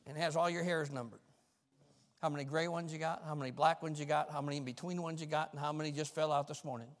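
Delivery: fast, light patter; playful quick aside. Real, not acted. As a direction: rattle off the joke list. Jokey aside about hairs.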